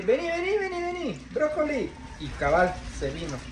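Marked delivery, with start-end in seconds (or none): 0.0-1.9 high, soft pet call, re-enacted; retold coaxing call. Owner retelling how the escaped parrot was called, high coaxing. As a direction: imitate calling a bird back, high and light. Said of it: "solo le dije".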